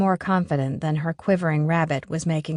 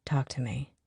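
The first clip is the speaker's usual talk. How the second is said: whisper; 5 semitones lower, 10 dB quieter than usual. A narrator's reading. Dan coaxing.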